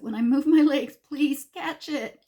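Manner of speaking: high, urgent retold plea; frantic, emphatic request; terrified pleading. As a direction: beg in mock panic, slowing at the end.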